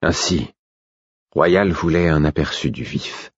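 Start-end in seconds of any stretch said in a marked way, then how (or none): none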